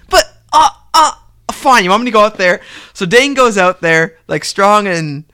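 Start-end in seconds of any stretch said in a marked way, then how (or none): 0.1-2.6 mock flustered, grudging surrender; raised voice, stammering into a reluctant yes; exasperated. Reluctant wrestler giving in to the authority figure, exasperated.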